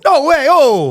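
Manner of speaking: high-pitched cartoon Italian; Italian accent. Pope giving a speech in Italian, high mock Italian accent. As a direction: exclaim it as a hammed-up Italian. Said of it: "he did a speech in Italian".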